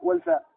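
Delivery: والفاء said high and pointed, correcting أو الفاء; high corrective emphasis on والفاء; stress on والفاء. Shown as a demonstration. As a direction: lift the و of والفاء. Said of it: "ليس أو الفاء".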